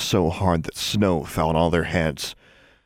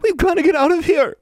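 high, loud sobbing cry; 6 dB louder than usual. A narrator's reading. Anna sobs to Alexandra, panicked. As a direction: sob it out in panic.

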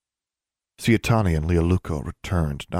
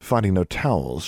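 ordinary delivery, nothing marked

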